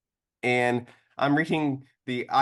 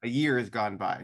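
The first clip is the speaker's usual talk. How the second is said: usual speaking voice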